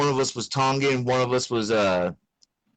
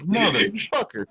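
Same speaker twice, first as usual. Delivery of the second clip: exasperated curse; 9 semitones higher, slower than usual. Retold or imitated. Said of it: "And I'd be like".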